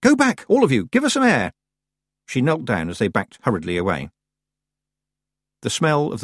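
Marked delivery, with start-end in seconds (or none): 0.0-1.5 high, loud urgent cry; screamed order. A narrator's reading. Tiffany clearing the crowd above her, screaming. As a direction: shout the crowd back. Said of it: "Tiffany screamed at the people".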